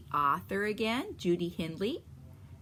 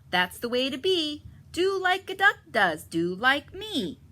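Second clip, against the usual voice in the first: loud, higher mother duck teaching; Mama Duck; 8 semitones higher, 7 dB louder than usual. Acted. Mama Duck teaching her ducklings, loud higher voice. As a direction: lead them like a proud mother duck. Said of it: "says Mama Duck".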